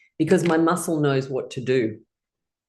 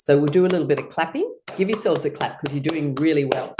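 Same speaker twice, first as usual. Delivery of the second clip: ordinary talk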